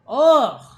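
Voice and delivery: loud, high ugh of disgust; real disgusted ugh; loud disgusted groan. Real, not acted. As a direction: groan it out, fed up.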